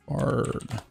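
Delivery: ordinary delivery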